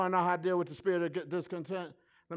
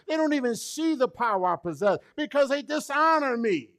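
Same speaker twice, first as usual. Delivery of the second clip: voicing Jesus; 7 semitones higher, 6 dB louder, faster than usual. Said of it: "Just like Jesus said".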